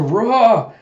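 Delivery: high, loud war cry; loud imitated battle yell; battle scream. Den rushes into battle with his sword, loud war cry. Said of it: "he screams out with a".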